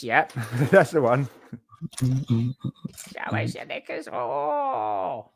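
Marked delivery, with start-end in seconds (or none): 2.0-5.3 parrot voice. Pretend. Parrot voice repeated slowly.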